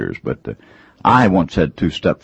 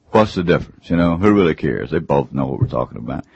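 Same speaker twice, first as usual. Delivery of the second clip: normal talking voice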